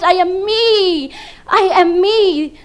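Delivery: usual speaking voice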